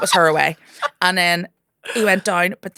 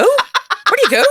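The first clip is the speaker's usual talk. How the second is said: even higher, louder, laughing; incredulous laughing; 8 dB louder than usual. Retold or imitated. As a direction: repeat it, cracking up in disbelief. Comedian reacting to absurd heckle.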